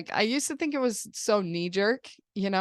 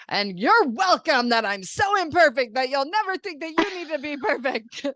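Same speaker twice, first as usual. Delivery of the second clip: higher, sarcastic self-mocking rant; mock tantrum; 6 semitones higher, 4 dB louder than usual. Mom re-enacting her tirade at the kids, mock indignant. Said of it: "having one of my adult temper tantrums".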